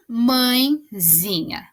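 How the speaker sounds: mãezinha stretched out slowly, each syllable clear; slow demo word. Shown as a demonstration. Teacher sounding out mãezinha for the class. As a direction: say mãezinha slowly like reading to kids. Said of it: "substantivo no grau diminutivo".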